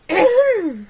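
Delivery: high, loud, exaggerated throat clear. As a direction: clear your throat pointedly and loudly. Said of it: "clearing her throat, loudly".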